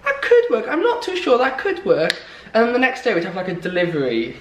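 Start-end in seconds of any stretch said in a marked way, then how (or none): 0.0-2.1 high, slow and unconvinced; doubtful. Retold or imitated. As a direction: hum and haw doubtfully. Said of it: "And I'd be like".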